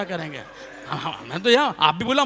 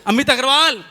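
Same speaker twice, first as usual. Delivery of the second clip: calling out; 5 semitones higher, 5 dB louder than usual. Retold or imitated. Calls name for echo.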